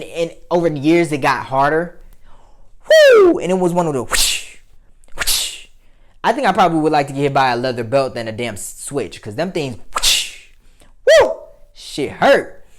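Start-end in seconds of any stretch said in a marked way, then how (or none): 2.9-3.4 high, loud cry of pain; loud whoo. Retold or imitated. Reacting to the switch's sting. 5.1-5.7 switch whoosh. Retold or imitated. Imitating a switch swinging. 9.9-10.5 switch whoosh. Retold or imitated. Imitating a switch swinging.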